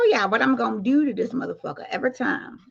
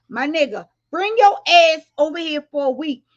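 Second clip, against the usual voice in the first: ordinary talk, nothing marked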